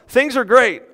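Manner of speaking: a cheerful high "things are great"; bright mock elation, lifted high; acted happy outburst. Preacher acting out the psalmist's happy mood, cheerful and high. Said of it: "It starts out".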